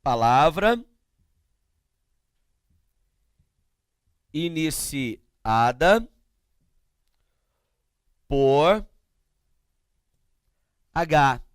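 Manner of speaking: word by word, long pauses between; slow dictation. Real, not acted. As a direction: dictate slowly as if writing it out. Dictates slowly while writing. (ditado lento, palavra por palavra)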